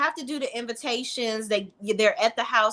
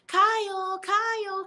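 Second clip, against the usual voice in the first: high whining call of his name; mock whiny calling; 11 semitones higher, slower than usual. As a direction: call him over and over in a high nagging voice. Imitating Amanda calling Kyle.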